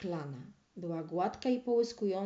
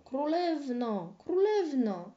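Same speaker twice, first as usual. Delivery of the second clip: vain calling; 5 semitones higher, slower than usual. A narrator's reading. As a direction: call her name, higher on the second. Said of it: "na próżno wołał". (daremne wołanie, coraz głośniej i wyżej)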